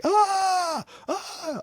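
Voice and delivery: mock squeal, very high; very high squeaky yelp. Breaking off the fake voice.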